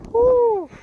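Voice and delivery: high, loud whoop; whooping with real excitement; excited whoop. Real, not acted. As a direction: let out a big happy woo. Morning rush, whooping aloud.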